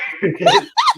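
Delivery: a sudden squealing laugh; laughter shooting way up high; high burst of laughter. Real, not acted. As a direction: break into a shrill laugh. Bursts out laughing at own joke.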